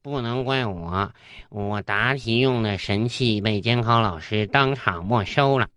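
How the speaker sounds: slow, low, hard-done-by pupil; aggrieved schoolboy. Acted. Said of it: "小明很委屈地答道".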